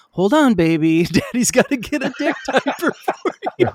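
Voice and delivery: deadpan text-message reading that breaks into a laugh; flat, uninflected read with a laugh. Shown as a demonstration. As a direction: read it with no inflection, laughing through the end. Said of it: "there's no inflection. So they all read it as".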